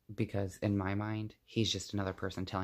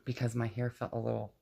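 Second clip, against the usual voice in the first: normal talking voice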